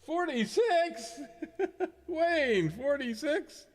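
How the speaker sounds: high, slow laughing disbelief; incredulous. Real, not acted. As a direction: squeal the number in amused shock. Incredulous at a guess.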